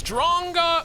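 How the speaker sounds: high-pitched mock scream of a name; imitated scream. Sister screams at her brother, mock scream. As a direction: screech it like a tattling little sister. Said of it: "she screams at the top of her lungs".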